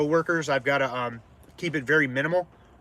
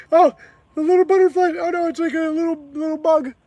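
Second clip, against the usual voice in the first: much higher, louder cutesy voice; cooing at a bug; 13 semitones higher, 5 dB louder than usual. Real, not acted. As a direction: coo at the little butterfly. Cooing at a butterfly.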